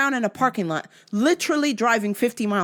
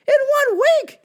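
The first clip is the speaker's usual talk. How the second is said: very high, loud exclamation; outraged repeat; 6 dB louder than usual. Acted. Caller to police about near killings, outraged cry. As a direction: squeal it louder in disbelief.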